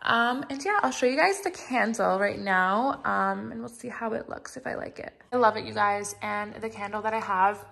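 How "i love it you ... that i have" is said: usual speaking voice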